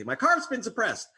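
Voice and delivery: a silly victim's whine; mock indignant. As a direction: say it like an absurd grievance. Mock quote of absurd phrasing.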